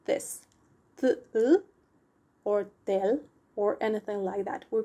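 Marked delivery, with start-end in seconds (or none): 1.0-3.2 "th-" with an added vowel, or "tel" as a syllable; mispronounced TL digraph. Shown as a demonstration. Teacher showing how not to pronounce TL, vowels added. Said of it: "we do not pronounce it like".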